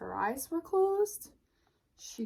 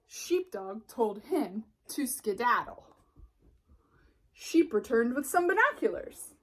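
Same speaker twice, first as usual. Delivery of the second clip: ordinary delivery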